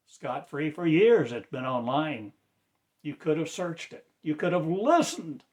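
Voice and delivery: loud reproachful preaching; rebuke. Real, not acted. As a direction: scold them for not listening. Rebuking the listeners.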